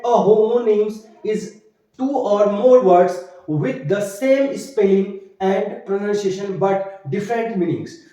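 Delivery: very slow, word by word definition read; slow dictation pace for note-taking. Shown as a demonstration. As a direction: dictate it slowly so students can write. Teacher dictates the English definition.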